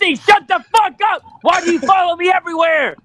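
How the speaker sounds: loud exasperated yell at a friend; shouting. Acted. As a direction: bark it out, fed up and loud. Mock outburst at co-host.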